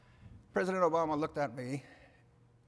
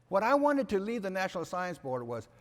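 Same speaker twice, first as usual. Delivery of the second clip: normal talking voice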